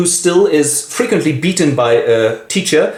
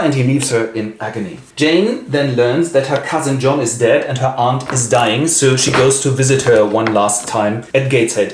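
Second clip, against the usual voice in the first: usual speaking voice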